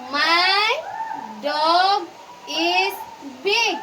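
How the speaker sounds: word by word, spaced; slow school dictation. Shown as a demonstration. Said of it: "pamati na".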